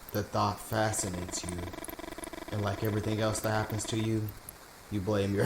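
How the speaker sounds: normal talking voice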